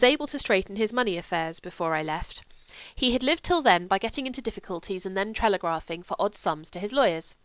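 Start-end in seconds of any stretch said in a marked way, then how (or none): none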